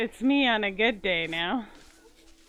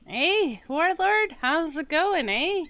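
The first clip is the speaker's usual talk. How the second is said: higher, louder hammed-up Canadian accent; mock Canadian greeting; 8 semitones higher, 5 dB louder, slower than usual. Streamer teasing the game voices with Canadian accent. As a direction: greet Warlord like a cheery Canadian. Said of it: "They all sound Canadian to me".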